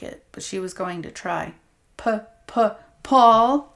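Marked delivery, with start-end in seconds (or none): 2.0-3.7 cruel high-pitched imitation of her stutter; the abuser mocks her frightened stammer; mocking stammer. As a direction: mimic her fear to taunt her. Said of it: "the man mocked".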